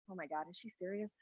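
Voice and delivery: barely audible, quick disbelieving aside; hushed aside. Retold or imitated. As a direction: mutter it under your breath, fast. Aside during Pam's rant.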